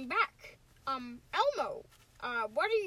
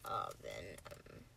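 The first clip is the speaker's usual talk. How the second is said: low, soft monster voice; slow, low creature announcing itself; Venom; 15 dB quieter, slower than usual. Acted. Practicing a character line.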